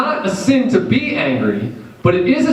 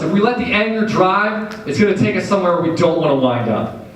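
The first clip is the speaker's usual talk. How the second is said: ordinary delivery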